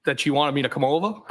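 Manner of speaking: "over" lifts in a questioning rise; answer as question. Real, not acted. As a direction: answer unsurely, letting "over" go up at the end. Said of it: "That was a question?".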